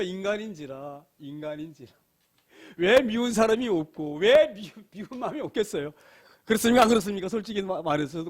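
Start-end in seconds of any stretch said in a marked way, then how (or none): none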